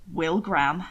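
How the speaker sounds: low, stern Hannibal voice; a hint of dignified menace; stern warning. A narrator's reading. Hannibal warning Will while treading water, lightly stern. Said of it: "stern warning".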